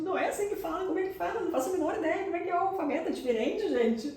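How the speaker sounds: high, baffled rush of questions; mock confusion. Student baffled by the teacher's correction, high and lost. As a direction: play your own bewildered younger self. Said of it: "E eu fiquei tipo".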